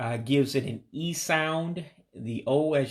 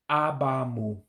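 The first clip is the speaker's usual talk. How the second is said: ordinary talk, nothing marked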